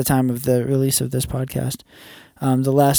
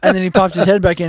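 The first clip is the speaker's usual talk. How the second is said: normal talking voice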